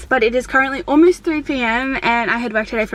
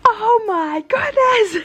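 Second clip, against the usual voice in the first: shocked gasp; 11 semitones higher, much slower than usual. Retold or imitated. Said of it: "And I was like".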